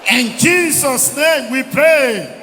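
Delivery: loud, slow, drawn-out proclamation; shouted prayer closing. Real, not acted. Preacher closes the congregation's prayer, loud and drawn out. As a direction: seal the prayer with a long shout.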